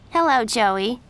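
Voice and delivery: fake-friendly girl voice; Miranda's greeting. A narrator's reading. Miranda sweetly greets her rival Jen, catty and loud.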